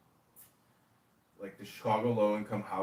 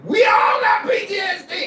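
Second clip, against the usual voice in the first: high, loud mock yell of the operator; hammed-up shout; 15 dB louder than usual. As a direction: bellow it like a street tough. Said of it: "He's like".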